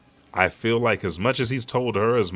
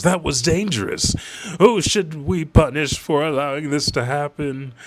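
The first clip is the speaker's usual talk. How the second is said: higher, louder, snooty noble's whine; haughty celestial dragon; 7 semitones higher, 4 dB louder than usual. Retold or imitated. Celestial dragon scolding the soldiers, higher, louder and snooty. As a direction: whine like a spoiled aristocrat demanding blame.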